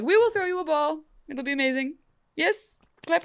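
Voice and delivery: gushing court welcome. Retold or imitated. Court excitedly promising a ball.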